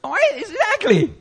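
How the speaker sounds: high, put-on voice for the locksmith; imitating the locksmith. Locksmith quote in story.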